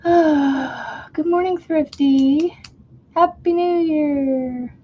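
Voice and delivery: high, slow, drawn-out greeting; sing-song greeting. Real, not acted. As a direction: stretch it out high and sweet. Greeting viewer in high stretched voice.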